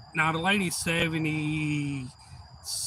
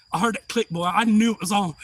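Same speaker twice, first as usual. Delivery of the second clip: ordinary talk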